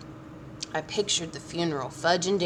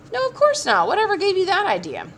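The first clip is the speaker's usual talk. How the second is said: much higher, startled protest; father caught off guard by his son's claim, higher and startled; surprised denial; 12 semitones higher, 5 dB louder than usual. A narrator's reading. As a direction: leap up in disbelief. Dad startled by accusation.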